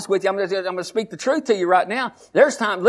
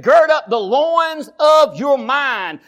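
slow, louder proclaimed verse; scripture quote; 4 semitones higher, 4 dB louder, much slower than usual. Acted. Pastor quoting scripture to the church, slow proclaiming. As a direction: intone the verse slowly. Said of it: "He said".

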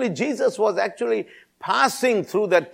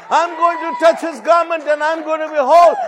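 high, forceful shouted affirmation; insistent climax of a repeated vow; loud repeated declaration; 5 semitones higher, 5 dB louder than usual. Retold or imitated. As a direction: proclaim it at full preaching force. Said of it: "said loudly, repeating to herself".

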